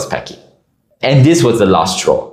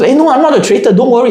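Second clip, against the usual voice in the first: much higher, breezy reassurance as Y; pitched-up mimic of a clueless player; imitating Y; 13 semitones higher, faster than usual. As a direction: brush it off in his high voice. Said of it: "And he goes".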